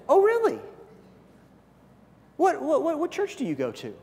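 high, bright "Oh, really?" then curious question; interested surprise. Pretend. Coworker suddenly curious about church, high surprised interest. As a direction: perk up, genuinely curious. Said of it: "if they do something like this".

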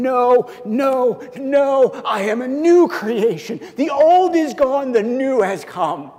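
high, loud emphatic refusal with a shout on the third no; imitating Paul's defiance. Paul imagined answering his accusers, high and insistent. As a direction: fling off the past, insistent. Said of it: "He's going".

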